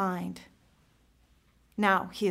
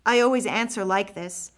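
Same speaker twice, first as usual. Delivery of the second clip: ordinary delivery, nothing marked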